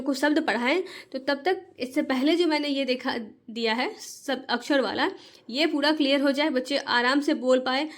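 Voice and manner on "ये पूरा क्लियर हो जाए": ordinary delivery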